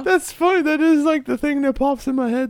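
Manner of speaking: talking through high laughter; laughing. Real, not acted. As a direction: speak while still laughing. Laughing at the quad joke.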